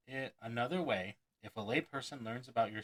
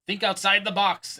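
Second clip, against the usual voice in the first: high, loud "Think outside the box!"; exclaimed cliché; 8 semitones higher, 11 dB louder than usual. Retold or imitated. Speaker mocking a cliché, loud exclamation. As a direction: proclaim the cliché. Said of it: "exclamation point".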